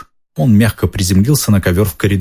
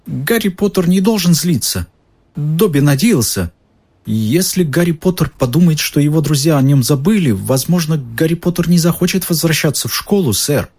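high, anxious elf voice; fretful little elf; house elf voice; 8 semitones higher than usual. Acted. Dobby anxiously explains.

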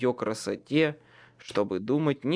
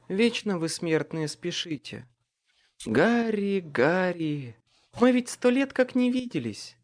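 amused faerie queen; 5 semitones higher than usual. Acted. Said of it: "Леа рассмеялась".

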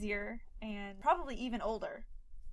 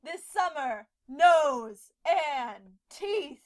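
dramatic trailer voice; 3 semitones higher, 9 dB louder, much slower than usual. Pretend. Horror movie tagline.

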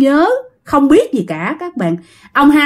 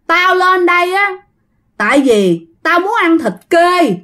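water ghost; 6 semitones higher than usual. Acted. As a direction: demand food as the possessing ghost. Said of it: "Thủy ý là ma nước".

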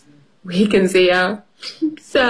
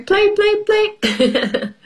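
put-on childish sing-song; 13 semitones higher, much slower than usual. Mimicking children's wish.

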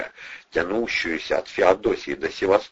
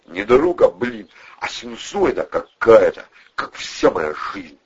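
thoughtful musing; 4 semitones higher, 4 dB louder than usual. A narrator's reading. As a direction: muse aloud to nobody in particular. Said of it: "он глубокомысленно обронил". (глубокомысленно обронить)

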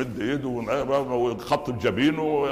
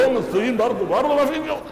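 much higher, louder mocking voice; scornful caliph retort; 12 semitones higher, 5 dB louder, faster than usual. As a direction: throw her complaint back at her, exasperated. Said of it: "يقول لي". (رد الخليفة الساخر بصوت عالٍ ومرتفع)

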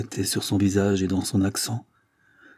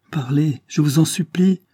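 high, louder desperate plea; pleading; 7 semitones higher, 4 dB louder than usual. A narrator's reading. Léopoldine on her knees begging her mother, high desperate plea. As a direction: beg her on your knees.

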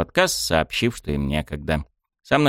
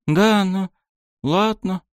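deflated; 12 semitones higher, much slower than usual. Acted. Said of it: "поникла та".

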